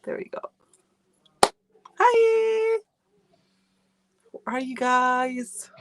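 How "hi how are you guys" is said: high, drawn-out sing-song hello; a high, slow, singing welcome to viewers; sing-song greeting. Real, not acted. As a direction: greet the chat like a bright chime. Greeting viewers joining the live.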